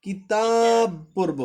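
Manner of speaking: slow demo stopping on কিতাব, last letter cut short; drawn-out recitation of কিতাব ending on a clean stop; stop at kitab. Shown as a demonstration. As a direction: read কিতাব slowly, breaking on the final ব with no vowel. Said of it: "কিন্তু আমরা থামলে কি করব?".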